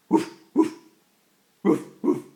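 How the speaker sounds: loud, breathy woofs; dog bark. Pretend. Parent playing dog for a child, loud woofing. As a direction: bark in short loud bursts.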